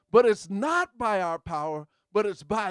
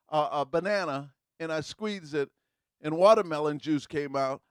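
ordinary talk, nothing marked

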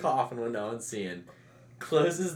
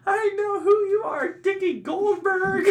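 very high, loud strained holler; mock threatening yell; 8 dB louder, slower than usual. Frat brother yells after fleeing Dickie, mock threat. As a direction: imitate hollering a name down the street. Acted pursuer yelling after runaways.

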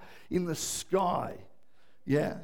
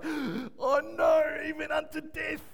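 mock dismay; 7 semitones higher, slower than usual. Preacher imitating readers reaching the word death, mock dismay. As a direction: gasp it out in horror. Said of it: "it's like".

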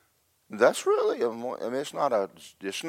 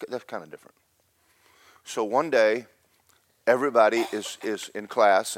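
ordinary talk

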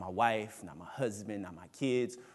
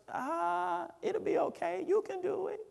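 high, coaxing voice of anxiety; preacher voicing anxiety as a high, coaxing tempter. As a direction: sweet-talk like a tempter whispering in the ear. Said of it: "Anxiety will play tricks on us and tell us".

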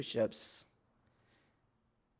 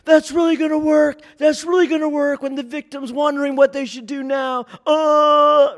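much higher, loud sarcastic yell; yelling instructor; 14 semitones higher, 11 dB louder than usual. Retold or imitated. Tac officer yells at a frozen recruit, loud sarcastic high-pitched yell. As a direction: bark the sarcasm like a drill instructor. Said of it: "someone will yell at them".

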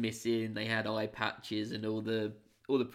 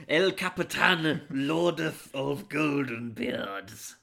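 mock grand title; 3 semitones higher, 5 dB louder, slower than usual.

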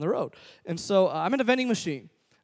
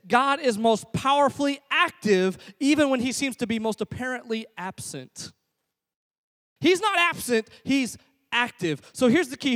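raised pitch, driving sermon delivery; emphatic preaching; 6 semitones higher, 4 dB louder than usual. Real, not acted. Preacher tells congregation what to remember, emphatic. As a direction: proclaim the key truth with weighty emphasis.

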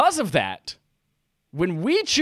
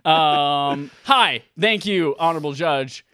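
ordinary talk, nothing marked

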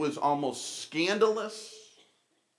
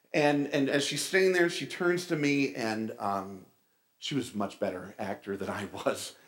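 ordinary delivery

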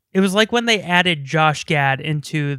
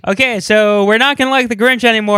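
loud, higher town-meeting announcement; mock meeting chair; 5 semitones higher, 6 dB louder, faster than usual. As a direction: address the whole town hall, hammed up. Acting out Who town meeting.

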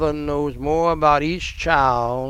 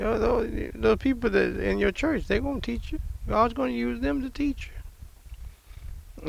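higher and softer, gentle and unbothered; very calmly; 8 semitones higher, 6 dB quieter than usual. Retold or imitated. Older pastor calmly advising a new pastor, soft and high. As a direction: reassure him quietly, no emphasis at all. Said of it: "He said very calmly".

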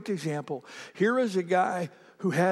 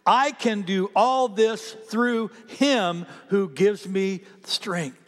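congregational reading; 4 semitones higher, 4 dB louder, much slower than usual. Shown as a demonstration. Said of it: "Read the last sentence with me".

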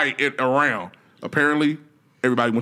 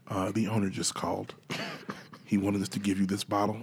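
soft, low, polite restaurant staff; hushed waiter voice; 6 semitones lower, 7 dB quieter than usual. Pretend. Waiter quietly comping a star's meal, hushed and polite. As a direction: murmur it like a waiter bringing a free bottle.

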